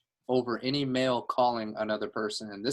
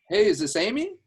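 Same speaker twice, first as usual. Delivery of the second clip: high, upbeat, louder opener; best-friend phone greeting; 8 semitones higher, 5 dB louder than usual. Shown as a demonstration. Manager cold-calls a stranger like a best friend, friendly high greeting. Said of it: "talk like I'm talking to a long best friend".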